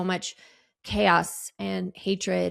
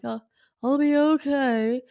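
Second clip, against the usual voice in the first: forced brave reassurance; 6 semitones higher, 3 dB louder than usual. Retold or imitated. Reassuring her crying son.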